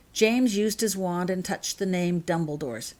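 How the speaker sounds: usual speaking voice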